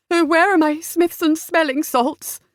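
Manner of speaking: high, fretful lady; distressed mother. A narrator's reading. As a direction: wail fussily for help. Distressed mother retreats to the sofa.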